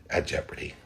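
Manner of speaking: a hushed whisper at the end of the thought; soft whispered inner voice. Retold or imitated. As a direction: drop the voice to a whisper, fear sinking in. Inner voice confronting his fear.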